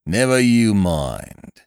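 slow, heavy warning from a policeman; stern slow constable rebuke; severe. A narrator's reading. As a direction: lay it down slowly and severely. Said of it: "he said severely".

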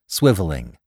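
ordinary delivery

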